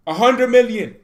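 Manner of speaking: loud, high outraged cry; exasperated fan shouting the figure. Real, not acted. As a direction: yell the sum in disbelief. Ranting about transfer spending.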